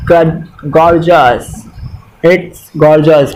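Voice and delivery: drawn-out slow delight; slow admiring. Retold or imitated. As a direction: savour "gorgeous" slowly. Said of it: "आप बोलते हैं". (नया कपड़ा देखकर धीमी, खिंची तारीफ़)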